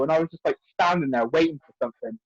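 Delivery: ordinary talk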